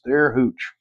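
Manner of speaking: ordinary talk, nothing marked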